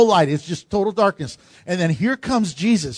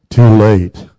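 low, loud and final; deep thundering rebuke; booming; 11 semitones lower, 11 dB louder than usual. Real, not acted. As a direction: slam it down like a verdict. Preacher rebukes waiting sinners.

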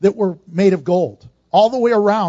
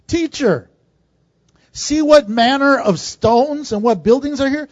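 higher, excited call to the teacher; amazed disciples; 5 semitones higher, 3 dB louder than usual. Retold or imitated. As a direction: imitate an awestruck disciple marvelling aloud. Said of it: "They're freaking out".

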